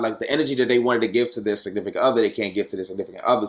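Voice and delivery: ordinary talk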